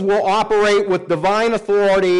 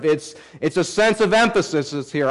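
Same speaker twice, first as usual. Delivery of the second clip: ordinary delivery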